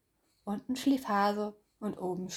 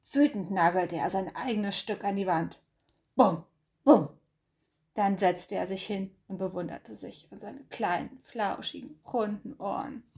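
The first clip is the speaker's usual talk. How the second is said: ordinary delivery, nothing marked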